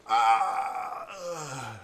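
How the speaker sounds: loud cry sinking into a groan; hammed-up dying groan; mock death cry. Kid stabbed under the arm in a game, hammed-up death cry. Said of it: "And the kid goes".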